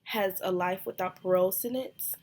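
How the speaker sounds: ordinary talk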